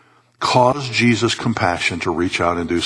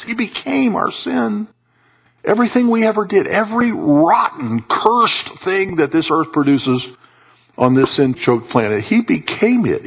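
high, emphatic preacher delivery; impassioned preaching; 9 semitones higher than usual. Real, not acted. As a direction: preach it with pitch raised, landing each phrase. Preaches at full force.

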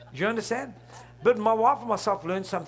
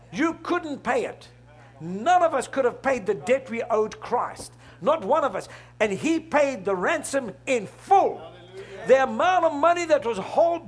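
loud, rising preacher's cry; a fiery pulpit climax; full-force preaching; 4 semitones higher, 4 dB louder than usual. Acted. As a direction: drive it home to the congregation.

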